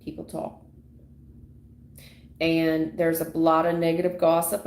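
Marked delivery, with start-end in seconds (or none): 0.0-0.6 whispered aside, barely voiced. Shown as a demonstration. To viewers, repeating a warning about gossip, whispered. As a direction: hush it like a secret.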